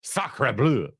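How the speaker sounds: lightly mimicked French accent; French exclamation. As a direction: toss in a mock French oath. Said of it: "the French producer was like".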